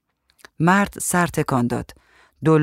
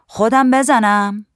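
slow, higher, raised-voice call; shouting from outside; 7 semitones higher, 5 dB louder, slower than usual. A narrator's reading. Painter shouts in from outside, calling loudly. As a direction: holler the question to someone indoors, drawn out. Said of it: "نقاش از بیرون داد زد".

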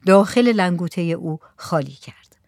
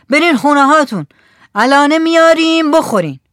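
raised, high-pitched voice of command; acted shout; 7 semitones higher, 7 dB louder than usual. A narrator's reading. Village headman to the women at the window, shouting. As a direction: shout it high and loud to send a crowd off. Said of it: "کدخدا بلند شد و داد زد".

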